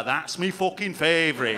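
light northern English accent on "That's me fucking favorite"; "me" said as a northern English "mi", a lightly put-on local accent; local British accent. Shown as a demonstration. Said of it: "I like that one there".

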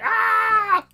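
long, high singer's "ah" in imitation; imitated high wail. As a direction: mimic the singer with a high held cry. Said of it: "his voice is like".

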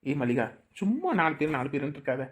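low, hushed sweet-talk to a wife; soft coaxing. Acted. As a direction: coax her softly, voice dropped low. Husband coaxing wife for another baby. (தணிந்த குரலில் கொஞ்சலாக)

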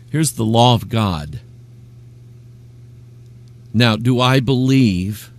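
ordinary delivery

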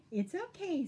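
high, unbothered reassurance; Bear. A narrator's reading. Bear soothes Skunk, high and easy. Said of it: "said Bear".

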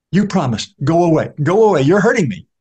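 the beggar pleads with Newman to keep his promise; pained pleading beggar. Acted. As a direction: beg him off, hurting.

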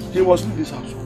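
soft, low-key order; muted ultimatum. Acted. Father's first, muted ultimatum.

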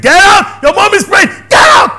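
high, loud commanding shout; God commanding. Retold or imitated. God ordering the man out of the nightclub, loud command. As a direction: order him out, booming. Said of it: "He said".

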